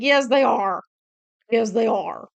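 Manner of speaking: loud, insistent comeback; emphatic retort. Retold or imitated. Arguing back that bears are vicious, loud and emphatic.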